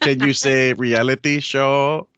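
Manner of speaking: slow, high teasing singsong; mock announcer. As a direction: tease like a game show host. Mock game-show teasing.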